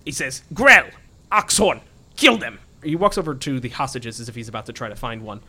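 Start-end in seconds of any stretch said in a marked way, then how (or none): 0.5-2.6 loud, high-pitched command to henchmen; raised-voice villain barking orders; shouted villain's order. Acted. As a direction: call out each name forcefully, then give the order.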